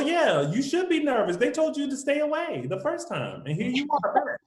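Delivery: high, incredulous telling-off; mock scolding. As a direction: scold her with exasperated disbelief. Acts out scolding the character.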